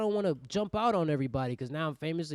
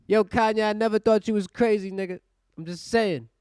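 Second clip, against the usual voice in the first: high, loud mock supporter; loud backing-up; 6 semitones higher, 10 dB louder than usual. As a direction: call out to him loudly in his defence. Plays a supporter answering [name].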